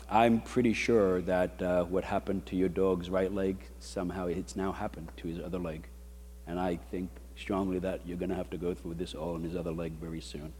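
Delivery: retold vet, low and very soft; soft, grave. Vet gives dog owner bad news, low and hushed.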